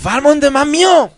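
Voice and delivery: loud, high soldier calling out; volunteer shouting across the field; eager loud call. Retold or imitated.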